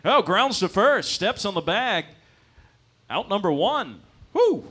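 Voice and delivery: loud, high play-by-play, stretched out; baseball commentator. Acted. Struggling commentator calling an out at first, loud excited announcer voice. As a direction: call the out, ending in a whoop. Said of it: "give it up for the commentators".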